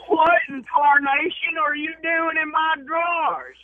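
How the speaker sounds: put-on high, loud, slow old-timer holler; old prospector. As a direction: bellow like a cranky old prospector. Old man catches cousin in drawers.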